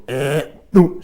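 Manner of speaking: grunted "eh" into a short hoot; tongue-slide chuckle sound. Shown as a demonstration. Teacher showing the tongue slide for a chuckle, grunt and hoot. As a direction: demonstrate the chuckle, tongue sliding in. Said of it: "it's like this".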